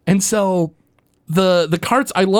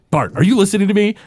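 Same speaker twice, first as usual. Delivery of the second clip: fast, higher nagging question; impatient know-it-all scolding; Martin impression; 7 semitones higher, faster than usual. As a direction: rattle it off at the daydreaming kid. Martin scolds distracted Bart.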